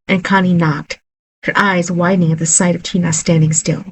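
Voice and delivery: ordinary delivery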